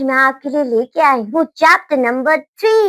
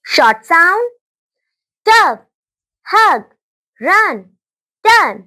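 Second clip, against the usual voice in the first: phonics drill; 3 semitones higher, slower than usual. Shown as a demonstration. Teacher demonstrating short and long sounds, emphatic. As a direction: model the sound for the class.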